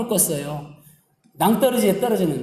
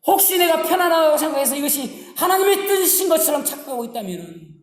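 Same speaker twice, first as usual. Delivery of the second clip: earnest prayer voiced as David, pitched higher; David's confession; 5 semitones higher than usual. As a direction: plead with God to search you. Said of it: "이렇게 고백합니다". (다윗의 간절한 고백 기도)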